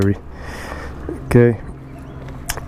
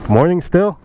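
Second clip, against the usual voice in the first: surprised question; 8 semitones higher than usual. Real, not acted. Surprised it is still morning.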